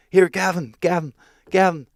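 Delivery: insistent retold name-calling. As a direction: mimic someone calling for a man's attention. Retold man calling for Gavin.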